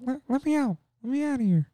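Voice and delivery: high, thin cry for help from behind a door; high trapped ghost voice. Retold or imitated. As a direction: wail it like a ghost stuck in a coffin.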